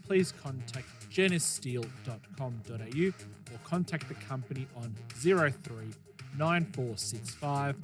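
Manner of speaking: ordinary talk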